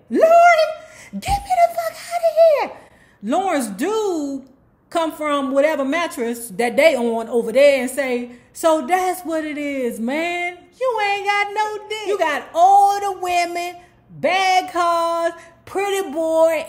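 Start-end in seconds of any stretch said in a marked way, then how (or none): 0.1-2.7 very high, loud yell for a friend; furious mock scream. Winter yells for Lauren to leave. 8.5-16.7 high, loud mocking taunt; loud jeering. Lauren's dude loudly mocks Boom.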